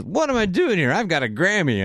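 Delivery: imitated punchline. Quoting a singer's punchline on a show, imitated diva. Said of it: "one of her punchlines is".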